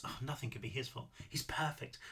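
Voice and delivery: hushed, adoring inner voice; swooning near-whisper; besotted thought. A narrator's reading. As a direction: breathe it very softly, as a lovesick thought. Besotted inner thought amid narration.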